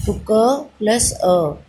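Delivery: each sound drawn out slowly; slow spelled-out sounds. Shown as a demonstration. Teacher to children, slowly sounding out क plus अ. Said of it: "sound आ रही है ना अ की".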